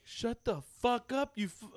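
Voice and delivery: high, slow, drawn-out mock anger; imitated mad kid. Kid snaps at salty losing team, imitated anger. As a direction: play a kid cussing out the losers. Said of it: "that's how I talk when I'm mad".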